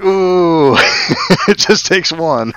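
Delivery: high, slow delighted laughing. Real, not acted. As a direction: gush with a laugh. Gleeful reaction to the line.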